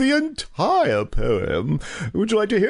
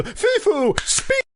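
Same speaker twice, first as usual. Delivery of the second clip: high, singsong command to a dog; higher, coaxing order to a pet; 9 semitones higher, slower than usual. Acted. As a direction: coax the little dog into speaking.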